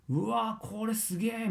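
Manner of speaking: a student stunned by their own new voice; amazed delight. Retold or imitated. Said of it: "みたいな感じですよ".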